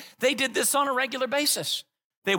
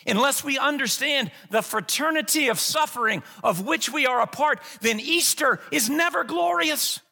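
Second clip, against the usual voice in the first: building sermon climax; 5 semitones higher than usual. Real, not acted. Pastor rousing the congregation toward Easter, building preaching. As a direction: build the fire of the sermon to a peak.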